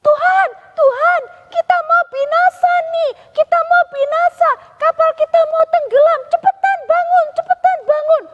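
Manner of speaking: high, loud begging to wake up; frantic disciples waking their master; urgent plea. Acted. Disciples waking sleeping Jesus, high frantic pleading. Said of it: "segera panggil Tuhan Yesus".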